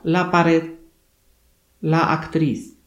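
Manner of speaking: "la pared, la actriz" with the stress on the last syllable; careful Spanish. Shown as a demonstration. Teacher shows a Polish class Spanish words, careful Spanish. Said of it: "kończą się na spółgłoskę dy albo z".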